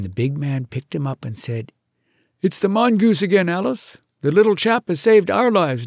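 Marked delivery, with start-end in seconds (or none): 2.4-5.9 a touch of a gruff big man, louder; lightly voiced man of the house, raised. A narrator's reading. Big man speaks to wife.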